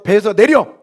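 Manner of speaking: a loud barked command; mock order. Imagined Jesus orders grumbling disciples off the boat, loud. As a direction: shout it, throwing them off the boat.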